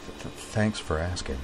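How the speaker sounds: soft, halting reassurance; quiet stammer. Acted. Kate assuring her roommate she'll be fine, quiet stammer. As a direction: barely voice it, stuttering. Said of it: "she replied quietly".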